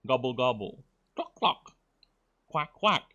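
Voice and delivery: gobble, cluck and quack like a turkey, hen and duck; a squeaky cluck, then a quacking; bird noises. Pretend. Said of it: "If I were speaking Burb, it would be like this".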